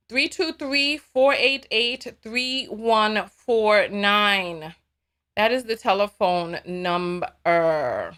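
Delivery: digits spelled out slowly; slow and deliberate. Real, not acted. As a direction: read the number slowly. Slowly reading phone number.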